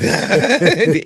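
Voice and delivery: laughing in a lifted voice; high laughing voice. Pretend. Laughing through the dreamy voice.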